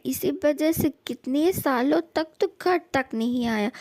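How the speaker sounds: usual speaking voice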